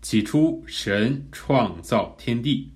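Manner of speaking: very slow reading, each word set apart; 逐字重复读. Shown as a demonstration. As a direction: push out every word slowly, one by one. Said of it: "然后我们再读一遍". (一字一顿，慢慢地读)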